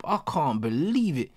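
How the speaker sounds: normal talking voice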